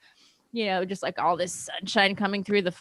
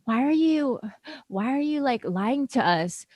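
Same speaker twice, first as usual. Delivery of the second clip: a hint of a higher mocking mom; mom's voice; 5 semitones higher, slower than usual. Daughter retelling mom's accusation, lightly mocking. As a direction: lightly mimic the accusing mother. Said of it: "she's like".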